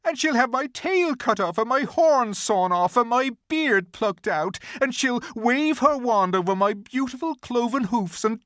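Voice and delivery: high, tearful pleading faun voice; a frightened faun near tears; crying faun. A narrator's reading. Faun tells Lucy of the witch's punishment, tearful and high. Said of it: "beginning to cry again".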